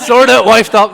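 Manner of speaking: laughing loudly through a tease. Real, not acted. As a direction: burst out laughing.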